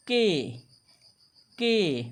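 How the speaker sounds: "ke" drawn out, falling; demonstrating "ke". Shown as a demonstration. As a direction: sound out "ke" slowly for learners. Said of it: "ए का उच्चारण जोड़ देंगे".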